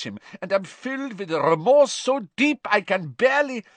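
fawning, begging for mercy; desperate prisoner. A narrator's reading. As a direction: Karkaroff pleading remorse to the court. Karkaroff desperate testimony.

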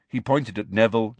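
ordinary delivery

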